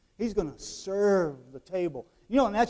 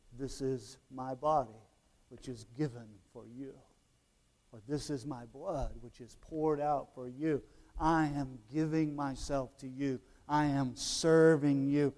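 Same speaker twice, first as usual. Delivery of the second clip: solemn, tender recitation; 5 semitones lower, 6 dB quieter than usual. Retold or imitated. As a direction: recite it softly, giving each phrase time. Reciting Jesus' words at communion.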